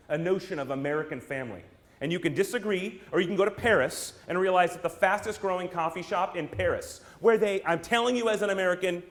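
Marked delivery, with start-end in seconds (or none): none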